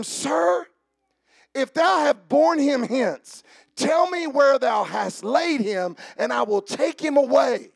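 loud, high, slow pleading; voicing Mary. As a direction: plead with the gardener. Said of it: "saith unto him".

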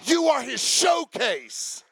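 high, loud preaching that keeps climbing and half sings the words; full-force preaching. Acted. Pastor preaching to his congregation in a rising, loud, half-sung voice. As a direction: build the sermon to a shouted, sung climax.

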